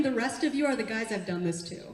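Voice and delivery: ordinary talk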